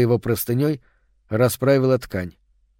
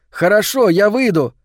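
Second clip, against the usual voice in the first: even higher and louder, eager; cheerful agreement; 7 semitones higher, 4 dB louder than usual. A narrator's reading. Boy eagerly tells the girl he's coming out, higher and louder. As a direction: jump at the chance. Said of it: "весело согласился он".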